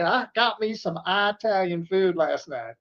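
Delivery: slow Texas drawl; Texas guy accent. Acted. Co-host mimicking a Texas guy, slow Texas accent. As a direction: draw it out like a Texan. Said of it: "sounds like something a Texas guy".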